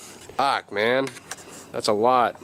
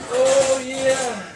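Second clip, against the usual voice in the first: high-pitched joking oh yeah; meme voice; 13 semitones higher than usual. Acted. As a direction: pitch way up for a laugh. Joking for the memes.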